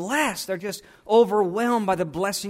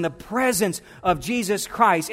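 ordinary talk, nothing marked

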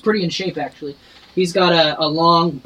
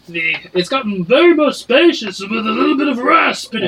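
raspy barkeep; 5 semitones higher than usual. Acted. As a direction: rasp it out like a sick barkeep. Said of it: "Your voice".

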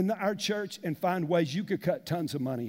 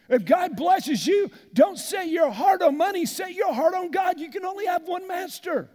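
preaching at full force; 8 semitones higher, 4 dB louder than usual. Acted. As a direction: thunder it from the pulpit.

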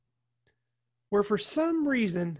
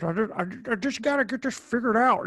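Jimmy Stewart. Retold or imitated. Host showing how the actor talked, classic-movie-star impression. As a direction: mimic a classic actor's folksy accent. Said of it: "he kinda went Jimmy Stewart".

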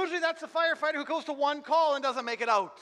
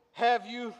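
ordinary talk

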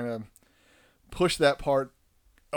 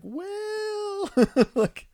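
very high drawn-out well; high awkward uh-well; awkward hesitation; slower than usual. Retold or imitated. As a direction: stall awkwardly with a laugh. Awkward reaction to son.

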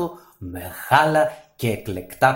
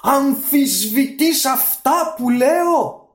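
high, loud, slow indignant genie; offended genie blowing up; genie outburst; 5 dB louder, slower than usual. Acted. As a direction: explode at the insult. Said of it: "ξέσπασε".

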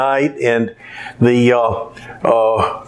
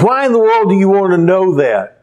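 higher, pressed question; indignant dad; 7 semitones higher than usual. Retold or imitated. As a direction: demand it in exasperation. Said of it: "He said".

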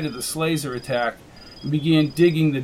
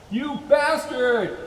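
anguished yell; 9 semitones higher, slower than usual. Acted. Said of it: "yelled at the sky".

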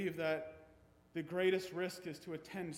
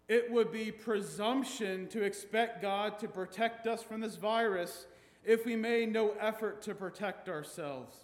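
preaching; 5 semitones higher, 7 dB louder than usual. Acted. Reads quote with rising force.

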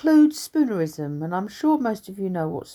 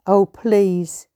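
loud for a deaf mother; 3 semitones higher, 6 dB louder than usual. Acted. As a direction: raise your voice so Mother can hear. Talking loudly to a deaf mother.

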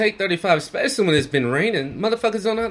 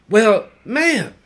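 louder, sharp call to the passenger; retold yell; 4 semitones higher, 6 dB louder than usual. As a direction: cut her off loudly. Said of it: "and I yelled".

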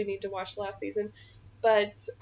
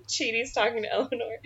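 usual speaking voice

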